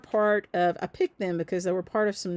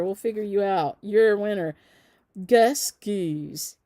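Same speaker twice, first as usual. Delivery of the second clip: ordinary talk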